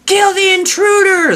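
high loud villain yell. Acted. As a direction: shout the order at the chimps. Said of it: "the Karen yells".

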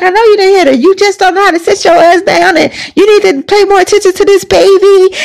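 high, fast grandma telling-off; scolding grandmother. Retold or imitated. Grandmother cusses out the mom for neglecting the baby. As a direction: chew her out like an angry grandmother.

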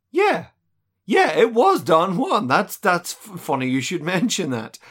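coy woman voice. Retold or imitated. Voicing Barrows coyly.

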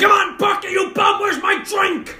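high, loud heckling yell; Bar Rescue host. Acted. Fan at the game heckling like a TV bar expert, shouted. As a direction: bellow abuse from the stands. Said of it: "yelling like the guy from Bar Rescue".

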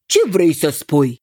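mildly startled king. A narrator's reading. King caught off guard by his cousin, mildly startled. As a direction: react with a touch of surprise. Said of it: "tresări regele Papătot".

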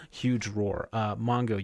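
ordinary talk, nothing marked

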